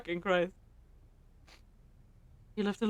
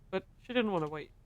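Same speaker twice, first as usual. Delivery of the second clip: normal talking voice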